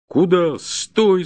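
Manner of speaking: high, loud iron-voiced command; thundering shout. A narrator's reading. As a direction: thunder it out to stop a thief. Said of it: "загремел вдруг железный голос Бирюка". (громовой окрик железным голосом)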